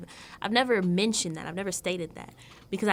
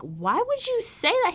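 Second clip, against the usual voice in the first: high, louder mock-scandalized question; shocked daughter; 6 dB louder than usual. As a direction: imitate a daughter appalled at her dad. Voices the daughter's shocked protest.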